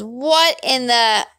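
slow, rising into a high cry; pained outburst cut short; alarmed cry. A narrator's reading. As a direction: start startled, break off into a scream. Man attacked in the water.

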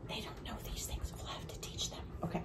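whispering softly to the camera; hushed confiding whisper; whispered aside. Real, not acted. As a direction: let the grown-ups in on a secret. Aside to viewers about kids.

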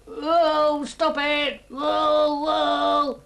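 high, loud wailing protest; hammed-up frog yelling in distress; distressed shouting. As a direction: cry out to be let off the spinning ride. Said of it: "shouting and waving".

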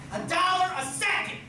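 high-pitched emphatic repeat; excited hype. Real, not acted. Host hypes the prize money.

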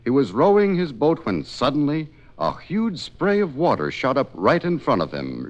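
ordinary delivery

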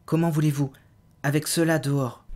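high and loud, scared protest; frightened plea. Acted. Frightened woman pleads she could not flee with vampires outside, high and loud.

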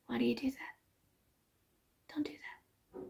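barely audible whispered complaint; mock pleading whisper. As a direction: whisper it as a joking warning. Mock plea to strangers, then kidding.

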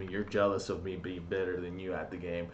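usual speaking voice

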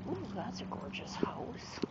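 hushed, breathless admiration; whispering. Real, not acted. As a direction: whisper it in awe. Admiring a passing house.

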